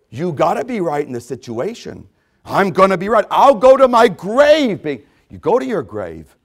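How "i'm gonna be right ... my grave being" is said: higher and louder, digging in; stubborn loud insistence. Retold or imitated. Preacher voices a stubborn churchgoer, loud defiant insistence. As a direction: insist on being right like a stubborn mule.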